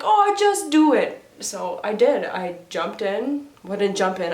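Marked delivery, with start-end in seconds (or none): 0.0-1.2 retold breezy urging. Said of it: "But she's like".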